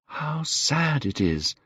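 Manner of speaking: slow, soft lament; sad. A narrator's reading. Dorian mourning his fading beauty, slow and soft. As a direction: let it sink slowly. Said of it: "said Dorian Gray".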